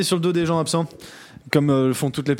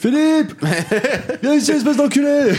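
high, loud laughing shout; mock yell; 10 semitones higher, 4 dB louder than usual. Cohost jokingly yells at the king, high and loud. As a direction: bellow the name across the room, laughing.

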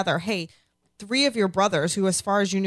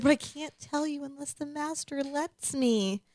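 higher, softer and slow, cowering; 1 timid Theon; 8 semitones higher, 5 dB quieter, slower than usual. Retold or imitated. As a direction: shrink back and plead timidly. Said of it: "He's like".

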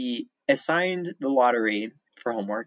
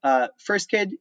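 mock teacher irritation, pitched up; higher, exasperated retold scolding; aggressive teacher, imitated; 9 semitones higher, 3 dB louder than usual. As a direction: act out a teacher cornering the class. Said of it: "he stomped in really aggressive in a terrible mood".

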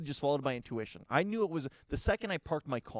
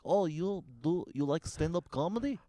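put-on Russian accent; 4 semitones higher than usual. Russian date asking him about stand-up, mock accent. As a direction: ask it in a halting Russian accent. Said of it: "'cause she was Russian".

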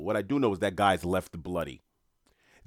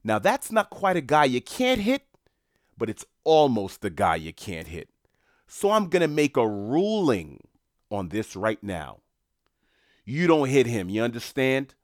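loud, higher threatening gangster warning; intimidating mobster; 3 semitones higher, 5 dB louder than usual. Retold or imitated. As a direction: lean on him like a Boston enforcer. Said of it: "points at the guy and goes".

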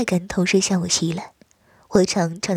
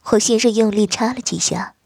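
ordinary delivery, nothing marked

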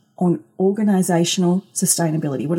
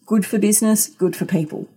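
ordinary talk

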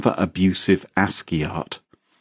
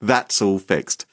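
sarcastic relief; 2 semitones higher than usual. Retold or imitated. Sarcastic mock relief quote.

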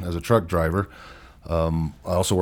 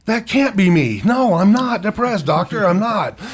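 much higher and louder, protesting; indignant denial; 13 semitones higher, 6 dB louder than usual. Retold or imitated. He protests to the doctor that he isn't depressed, higher and louder. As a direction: insist hard that it can't be you.

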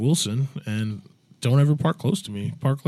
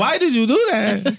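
high, slow, laughing mock Greek voice; a Greek accent that slips toward Spanish; 10 semitones higher, 4 dB louder, slower than usual. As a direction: laugh through a hammy Greek impression. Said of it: "sounds like your Spanish accent".

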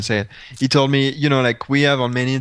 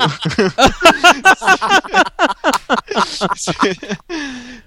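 loud, high burst of laughter; long cackling laugh, tailing off; real big laugh; 11 semitones higher, 5 dB louder than usual. Real, not acted. Laughing at the manual punchline, uproarious.

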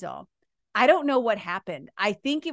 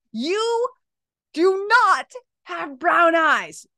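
loud, high, drawn-out mock yell; retold yelling; 6 semitones higher, 4 dB louder, much slower than usual. Retelling how she yelled at her friend, loud and emphatic. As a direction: re-enact yelling, hitting each word slowly. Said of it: "yelling at her".

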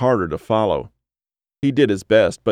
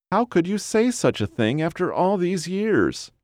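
high, wounded whine; whiny wife; 10 semitones higher than usual. A narrator's reading. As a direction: protest to your husband in a high whiny voice. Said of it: "a whiny voice said".

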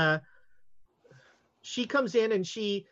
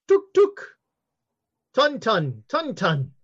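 calling a pet; 3 semitones higher, 5 dB louder, slower than usual. Acted. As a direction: coax the gorilla out like calling a pet. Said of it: "Like she's calling a pet".

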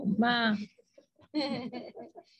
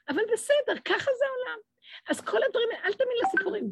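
high aside; 13 semitones higher, faster than usual. Real, not acted.

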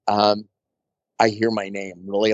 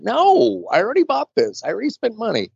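high protest; 11 semitones higher than usual. Retold or imitated. Protesting to friend about outfit.

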